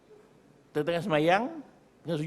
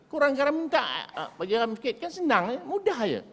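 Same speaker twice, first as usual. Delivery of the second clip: high, lilting easy-going ask; light high mock request; 11 semitones higher than usual. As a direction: make it sound effortless and light. Preacher shows easy request. (suara tinggi ringan, permintaan mudah)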